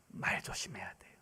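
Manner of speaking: hushed pulpit warning; whispered warning. Real, not acted. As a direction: whisper the caution.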